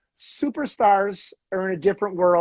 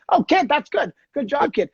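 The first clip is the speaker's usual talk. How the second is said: higher, lightly voiced praise for a rookie; a hint of a cheery veteran encouraging the kid; Wayne Gretzky; 8 semitones higher than usual. Star veteran praising the nervous rookie at bench press, lightly voiced and higher.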